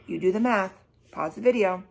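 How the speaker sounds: ordinary delivery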